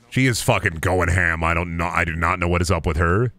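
ordinary delivery

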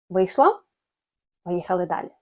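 ordinary talk

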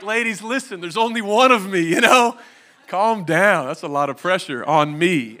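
hammed-up plea for calm; mock pleading husband. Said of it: "sometimes I'm like".

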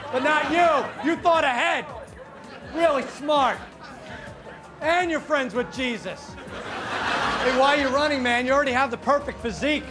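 ordinary talk, nothing marked

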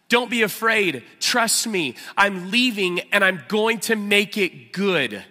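loud, high, driving sermon voice; impassioned pulpit preaching; full-force preaching. Acted. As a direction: preach it out with fire to the room.

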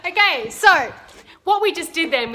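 usual speaking voice

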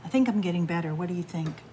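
usual speaking voice

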